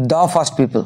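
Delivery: দা as "duh" before first. Shown as a demonstration. Teacher applies the rule in revision, দা as "duh". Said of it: "consonant আছে".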